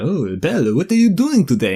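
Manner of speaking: high, swooping mock French accent. Minor Disney character greeting Belle, in a French accent. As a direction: put on a sing-song French villager accent. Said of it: "will all speak, um, with French accents".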